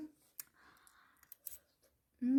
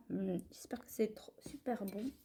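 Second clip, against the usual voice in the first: soft hushed whisper before tasting; 7 dB quieter than usual. Real, not acted. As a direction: whisper it, hopeful. Whispering before first taste.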